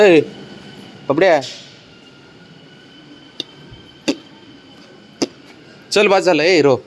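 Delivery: sing-song summons; calling out. Real, not acted. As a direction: call him over across the yard. Parent calling child up.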